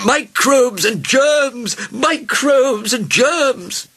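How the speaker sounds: slow, high alarm chant; loud, shrieked health warning; shouting doctors. A narrator's reading. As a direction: shout each word out as a drawn-out warning. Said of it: "shouted the doctors as one doctor".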